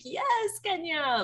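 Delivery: high squealed yes, Kenya; delighted fan squeal; cheering. Retold or imitated.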